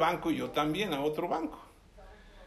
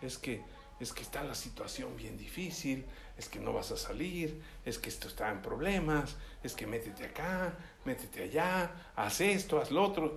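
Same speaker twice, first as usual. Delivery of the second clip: low, hushed devil's voice; feed worries quietly as the devil; devil tempting; 5 semitones lower, 8 dB quieter than usual. Acted. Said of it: "el diablo".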